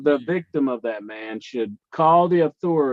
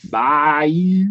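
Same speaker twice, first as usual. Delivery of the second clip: higher, louder southern "Bayou"; southern accent; 4 semitones higher, 6 dB louder than usual. Shown as a demonstration. Co-host retries after a no, stronger southern accent. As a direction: lay the southern accent on thick. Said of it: "in his most southern accent".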